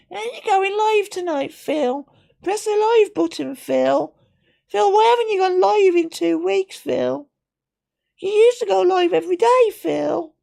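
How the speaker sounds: very high whining mock voice; whiny viewer Andrew. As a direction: nag like a crying fan. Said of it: "we know you cry, Andrew".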